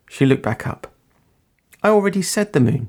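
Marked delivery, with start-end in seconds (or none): 1.8-2.9 high-pitched, mildly reproachful reminder; hint of reproach. A narrator's reading. Girl correcting her visitor, hint of reproach. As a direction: chide lightly in a higher voice. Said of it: "with a hint of reproach".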